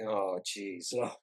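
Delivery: low, hushed mock groan of dismay; retold dismay. Retelling reaction to the odd company name, quietly dismayed. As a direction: drop low and soft, retelling your exasperation. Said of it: "I was like".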